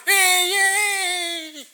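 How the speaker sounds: high, loud hammed-up cheer of delight; sarcastic mock celebration. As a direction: squeal it like a fake-happy disciple. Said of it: "Are you going".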